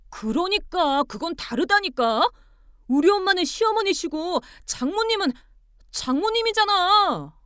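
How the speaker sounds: high, loud protest; exasperated insistence. Acted. Husband arguing mothers differ, exasperated. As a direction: insist hotly, climbing in pitch.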